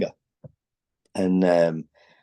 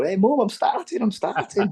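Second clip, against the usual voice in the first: much higher, thrilled call to the stand; excited shout to mum; 13 semitones higher than usual. Retold or imitated. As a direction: shout up to mum, bursting with joy. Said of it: "Like this".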